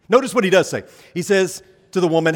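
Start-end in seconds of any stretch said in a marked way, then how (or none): none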